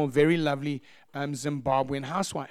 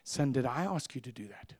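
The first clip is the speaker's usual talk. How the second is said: soft, low retold divine rebuke; understated, gentle reproof; voice of God; 4 semitones lower, 8 dB quieter than usual. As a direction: quietly question him as a patient father would. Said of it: "Holy Spirit said to me".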